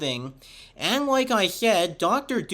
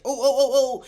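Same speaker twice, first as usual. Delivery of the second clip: high, loud string of excited whoops; hyper-enthusiastic; 7 semitones higher, 5 dB louder than usual. Retold or imitated. Reviewer quoting a changed cartoon line, hyper-enthusiastic. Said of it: "a really hyper-enthusiastic".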